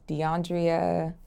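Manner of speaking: "[name]" said slowly as dee-AHN-dree-uh; mispronounced name. Shown as a demonstration. Interviewee lists what people wrongly call her, mispronounced name. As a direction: add an ee before the last uh in "[name]". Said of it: "Do people mispronounce this?".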